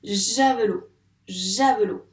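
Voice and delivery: "javelot" loud with a lengthened first j; emphatic word dictation. Shown as a demonstration. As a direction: press on the j and ja of "javelot". Teacher sounds out word for pupil.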